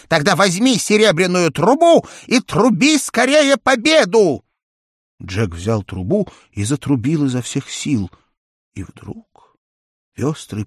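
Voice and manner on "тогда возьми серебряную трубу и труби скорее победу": high, loud eager bird voice; excited parrot. A narrator's reading. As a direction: urge him on, squawking. Попугай торопит Джека. (взволнованный голос попугая)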